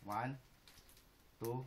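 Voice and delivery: ordinary delivery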